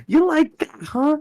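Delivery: very high mocking taunt; squeaky taunt. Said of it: "I'll be Doctor Lingham".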